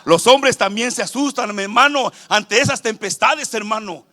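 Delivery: loud, driving sermon on men fearing storms; fervent preaching. Acted. Preacher telling the brothers men also fear, loud and fervent. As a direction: hammer the point home loudly.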